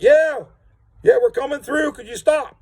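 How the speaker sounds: high-pitched, loud imitation of a distant shout; retold holler back. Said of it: "They holler back".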